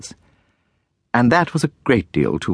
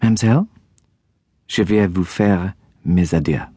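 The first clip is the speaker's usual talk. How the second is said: put-on polished French accent, a cool farewell; perfect French accent. A narrator's reading. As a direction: say it like a native French speaker. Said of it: "said in French to Miss Pinkerton with a perfect accent".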